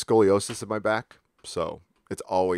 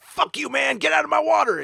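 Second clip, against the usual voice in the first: much higher, squeaky shouting fish; angry fish voice; 3 dB louder than usual. Retold or imitated. As the Hawaiian state fish guarding its water, squeaky shout. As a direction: voice a tiny furious fish chasing you off. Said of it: "It looks like, it's like".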